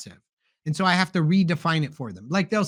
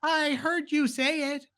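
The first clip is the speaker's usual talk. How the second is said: slow, much higher sarcastic comeback; mock weary retort; 10 semitones higher, 3 dB quieter, slower than usual. Parent mocks the reply to a repeated hint, slow high sarcasm. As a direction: tease it out slowly, high and dry.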